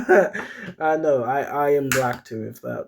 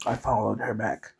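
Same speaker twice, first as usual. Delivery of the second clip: plain reading voice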